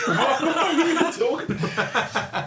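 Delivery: high, slow, laughing question; laughing disbelief. Real, not acted.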